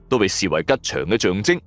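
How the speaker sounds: ordinary delivery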